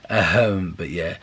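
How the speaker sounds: usual speaking voice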